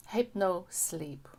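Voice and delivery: ordinary delivery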